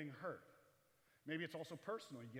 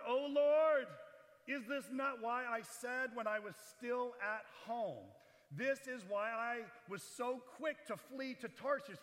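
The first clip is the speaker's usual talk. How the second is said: angry prophet; 6 semitones higher, 5 dB louder, slower than usual. Acted. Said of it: "Jonah's angry".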